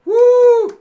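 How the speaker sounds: high, loud excited woo; loud cheering whoop. Real, not acted. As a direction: let out a big whoop. Cheering the revolution.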